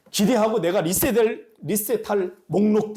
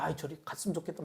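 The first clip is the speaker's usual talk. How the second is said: irritated muttering; 2 semitones lower, 14 dB quieter, faster than usual. Retold or imitated. Quoting his past irritated thought.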